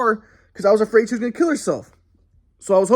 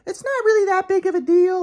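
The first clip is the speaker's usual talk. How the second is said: much higher, airy dismissive parody; mocking Sylvie; 11 semitones higher than usual. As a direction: make her sound careless and dismissive.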